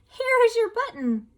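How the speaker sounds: high, loud eager call; excited cry. A narrator's reading. Frog calls to Toad on the dark paths, high eager cry. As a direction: shout it out with hope.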